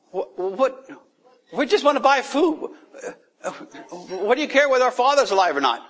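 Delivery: high, fast, exasperated protest with a laugh; indignant brothers. Retold or imitated. Brothers protest to the powerful man, high and fast. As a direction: play the baffled brothers pushing back.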